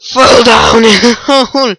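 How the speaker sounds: normal talking voice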